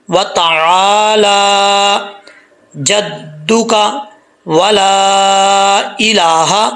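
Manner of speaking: slow, drawn-out chanted recitation; slow Quranic recitation. Acted. Teacher reciting the sana prayer for students, melodic and slow.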